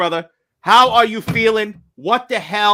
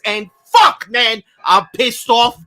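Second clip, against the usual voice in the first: ordinary talk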